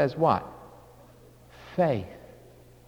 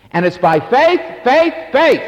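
"faith" repeated louder and higher; rising emphasis; 6 semitones higher, 5 dB louder than usual. Acted. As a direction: hammer "faith, faith". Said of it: "repeated word emphasis".

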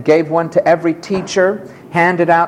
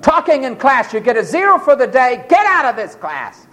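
higher, stern scolding; irate teacher, pitched up; angry teacher; 7 semitones higher than usual. Retold or imitated. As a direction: order him out of class. Angry teacher expelling student.

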